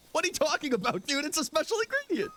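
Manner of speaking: high, squeaky fake-innocent protest; high mock innocent. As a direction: play dumb in a high squeak. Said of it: "The way you said it was sus".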